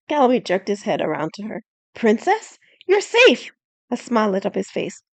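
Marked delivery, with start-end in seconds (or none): 1.9-3.5 high, loud joyful relief; delighted surprise. Acted. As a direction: burst out in relief. Knight relieved to find princess.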